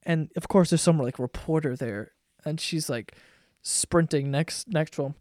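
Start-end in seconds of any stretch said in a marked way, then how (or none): none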